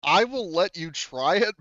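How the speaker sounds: usual speaking voice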